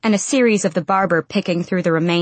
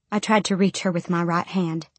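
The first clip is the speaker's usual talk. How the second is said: plain reading voice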